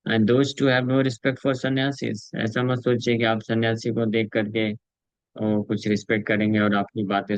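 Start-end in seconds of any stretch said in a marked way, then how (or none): 0.1-2.2 measured, very slow reading of the translation; solemn scripture translation. Acted. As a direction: read each word deliberately to the listeners. Reads translation slowly.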